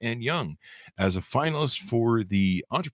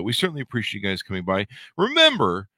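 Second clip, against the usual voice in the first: ordinary delivery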